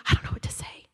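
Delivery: a soft, unvoiced panicked whisper; imitating a scared student. Shaking student on a live phone call, whispering. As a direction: whisper it while frozen with nerves. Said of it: "they start shaking".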